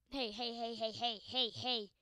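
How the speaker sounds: repeated hey in a put-on voice; mock calling voice. Gamer calling to a character in a silly voice. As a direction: call out hey over and over playfully.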